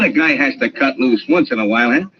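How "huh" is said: ordinary delivery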